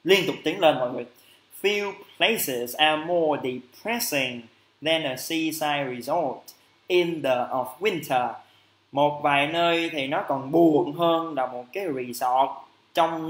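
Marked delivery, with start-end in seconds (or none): 1.6-8.3 very slow, deliberate English reading; slow reading aloud. Shown as a demonstration. Teacher reads the test sentence slowly to students. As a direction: read the sentence out slowly for learners. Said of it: "reads the exam sentence".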